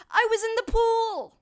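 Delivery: high, loud protesting cry; hammed-up protest; defensive shout. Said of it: "Michael says".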